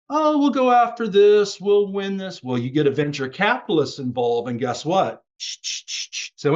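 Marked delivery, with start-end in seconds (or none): 0.1-2.4 high, loud mock-eager quote; eager entities. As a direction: voice their gung-ho boast, pitched way up. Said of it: "entities that used to be like".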